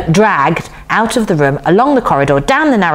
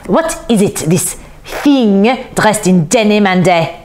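slow, disgusted put-on man's voice; theatrical horror, drawn out; appalled appeal; 4 semitones higher than usual. A narrator's reading. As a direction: raise the pitch and recoil in distaste. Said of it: "he appealed to the older woman".

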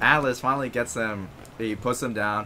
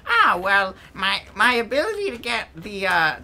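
higher, louder mad scientist; villain voice; 9 semitones higher, 3 dB louder than usual. Acted. Villain explaining his scheme, hammed up. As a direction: ham up a cartoon villain. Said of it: "He's like".

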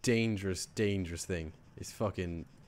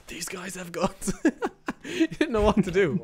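higher voice breaking into laughter; real laughing; 5 semitones higher, slower than usual. Real, not acted. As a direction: talk through helpless giggles. Cracking up after the encounter.

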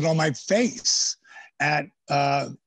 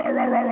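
mock angry ranting; 7 semitones higher, faster than usual. Imitating angry wrestlers yelling at him, mock ranting. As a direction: rattle it off like an angry locker room.

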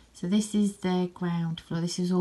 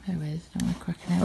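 usual speaking voice

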